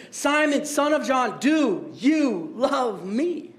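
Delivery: drawn-out, word-by-word asking; very slow, pointed plea for an answer; slow, insistent question. Acted. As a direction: stretch each word of the question, rising at the end.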